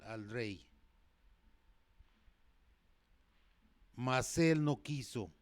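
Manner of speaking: loud, forceful pulpit reading; full-force sermon voice; fiery preaching. Acted. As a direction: preach it out to the whole church.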